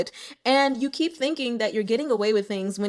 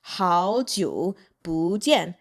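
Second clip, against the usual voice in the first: "Hao jiu bu jian" very slowly, in pieces; slow demonstration; 3 semitones lower, much slower than usual. Shown as a demonstration. Teacher showing how to say long time no see, slow "Hao jiu bu jian". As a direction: separate "Hao jiu", then "bu jian", drawn out.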